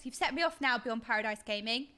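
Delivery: ordinary talk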